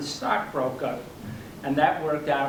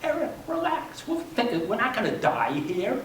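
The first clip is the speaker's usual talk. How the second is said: high, lightly imitated wife calming him; exasperated soothing, voiced for a story; retold reassurance; 7 semitones higher than usual. Wife calming her agitated husband.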